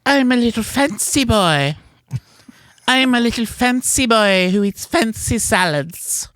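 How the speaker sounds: put-on high, prissy fancy-boy voice; squeaky high mocking dandy; Tim impression. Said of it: "here's my impression of Tim".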